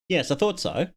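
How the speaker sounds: normal talking voice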